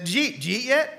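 "Jeet, Jeet yet?" run together as one word, Oklahoma style; slurred Oklahoma Jeet. Shown as a demonstration. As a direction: slur did you eat into "Jeet". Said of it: "we just kinda combine".

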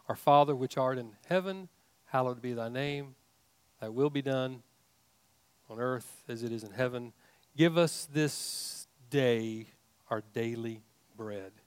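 hushed reverent Lord's Prayer; prayer recitation. Acted.

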